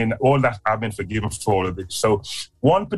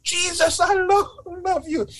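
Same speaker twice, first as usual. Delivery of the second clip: high, breaking cry to Jesus, much higher; tearful cry of love. Retold or imitated. A broken man crying out his love to Jesus, high and tearful. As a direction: sob it out high in surrender. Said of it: "this is what I'm saying now".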